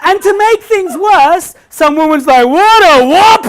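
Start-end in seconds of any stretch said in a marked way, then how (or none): 2.4-3.5 loud, high jeering call; a woman heckling from the crowd, yelled; mock heckler shout. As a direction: shout it across the room.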